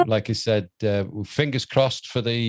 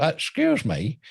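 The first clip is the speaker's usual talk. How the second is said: higher-pitched comic impression; a brief mimicked polite interjection; Donald Pleasance; 6 semitones higher, slower than usual. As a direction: put on a raised character voice. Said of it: "Donald Pleasance. He goes".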